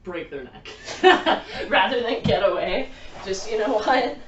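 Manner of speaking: talking loudly through laughter; laughing. Real, not acted. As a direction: laugh while making the point.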